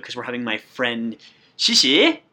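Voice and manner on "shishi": announcing guest. Real, not acted. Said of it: "dun, da, da".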